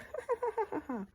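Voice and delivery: a shrill little giggle of scorn; high mocking laugh. Kathy laughing at Heathcliff, high giggle.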